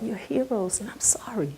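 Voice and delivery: soft, hushed whisper of an apology. Retold or imitated. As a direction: whisper it humbly to the men. Grandma apologizing to the veterans.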